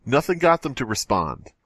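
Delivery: ordinary talk, nothing marked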